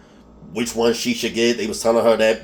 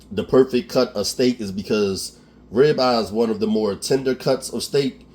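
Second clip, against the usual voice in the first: ordinary talk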